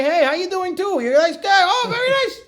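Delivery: very high, gushing small talk; mock friendly chat. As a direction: greet the talking car with exaggerated delight. Chatting happily with a talking car.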